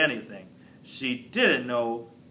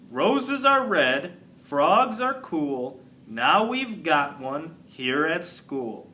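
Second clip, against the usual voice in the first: slow recital; slower than usual. Acted. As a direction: plod through it deliberately. Garth reads short poem slowly.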